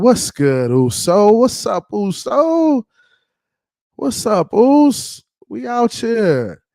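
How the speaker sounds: high, drawn-out hyped greeting; calling out. Real, not acted. Calling out to arriving friend.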